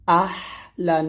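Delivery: আহলান with squeezed, thin long H; pressed ha. Shown as a demonstration. Teacher demonstrating throat pressure, আহলান with pressed H. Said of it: "চাপ দিয়ে চিকন করে বলতে হবে".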